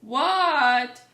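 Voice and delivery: loud, long-held answer; drawn-out shouted reply; calling back. A narrator's reading. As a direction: holler back to a parent. Froggy calls back to father.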